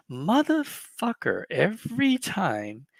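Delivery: fed-up collector groaning at endless complaints; exasperated. Real, not acted. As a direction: stretch it slowly, fed up. Exasperated rant.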